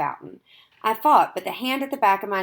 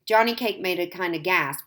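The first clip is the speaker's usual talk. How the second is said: usual speaking voice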